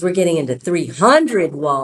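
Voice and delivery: spring the surprise, hitting "hundred" hard; excited reveal. Retold or imitated. Saleswoman reveals the real Walmart news, excited.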